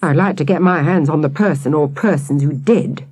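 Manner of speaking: usual speaking voice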